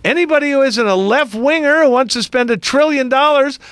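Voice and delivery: mock offence at being lumped in; sarcastic indignation. Real, not acted. Host objecting that all Republicans get blamed, high sarcastic protest.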